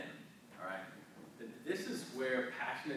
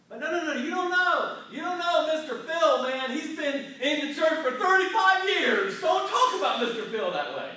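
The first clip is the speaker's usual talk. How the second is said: mock outraged member; 14 semitones higher, 16 dB louder than usual. Mock offended church member.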